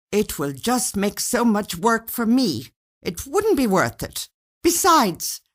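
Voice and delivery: irritated husband protesting; indignant husband. A narrator's reading. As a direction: push back hard, exasperated. Husband refusing, annoyed.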